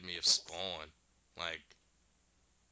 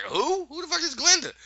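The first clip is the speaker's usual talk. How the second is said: much higher, louder disbelief; incredulous outburst; 8 dB louder than usual. Retold or imitated. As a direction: shriek it in total disbelief. Witch baffled by stranger's question.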